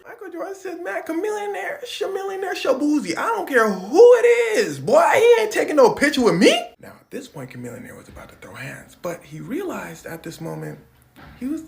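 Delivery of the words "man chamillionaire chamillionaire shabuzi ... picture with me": aggressive shout. Retold or imitated. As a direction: yell it down at him. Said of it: "saying this in an aggressive tone".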